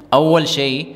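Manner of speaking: usual speaking voice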